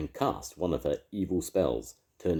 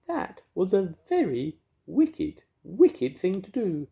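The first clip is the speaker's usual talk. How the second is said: very high, slow, louder fairy voice; fairy crying out; 6 dB louder, slower than usual. A narrator's reading. Mavis scolding Edna in a very high fairy voice. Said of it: "cried Mavis".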